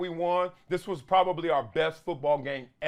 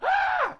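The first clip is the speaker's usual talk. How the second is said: imitated scream. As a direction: mimic a hurt kid's shriek. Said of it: "Same scream".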